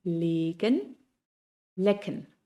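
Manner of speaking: legen long, lecken short. Shown as a demonstration. Teacher contrasting the two German vowels. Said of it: "Una suena larga y la otra corta".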